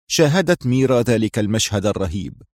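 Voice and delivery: usual speaking voice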